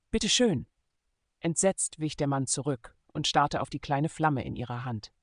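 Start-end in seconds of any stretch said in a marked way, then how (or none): 0.1-0.7 curt and annoyed. Acted. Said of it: "riss ihm unwirsch das Feuerzeug aus der Hand".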